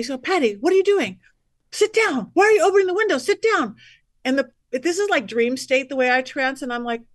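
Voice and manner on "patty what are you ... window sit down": higher and louder, half-shouted orders; retold yelling. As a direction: imitate him yelling at you to sit down. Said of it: "he's yelling at me".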